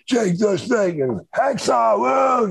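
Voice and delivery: high mock fan yells; imitating screaming fans. Fans outside the doors screaming for the wrestlers, mock yells. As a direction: yell the names like a crowd outside the doors.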